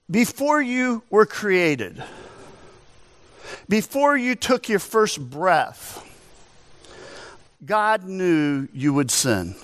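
slow, weighty preacher's pause between phrases; slow solemn emphasis. Acted. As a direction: let each phrase land slowly. Slow emphatic sermon point.

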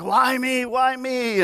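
high despairing why me; despairing cry. Retold or imitated. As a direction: plead up at the sky in mock despair. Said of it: "He's like".

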